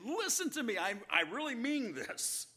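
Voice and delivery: high, loud, urgent plea that stretches out the final truly, truly; Jesus urging his disciples to remember, loud and pleading; Jesus pleading with love. Acted. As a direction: grab them by the lapels, lovingly, not angrily. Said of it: "taking his disciples by the lapels with love, not anger".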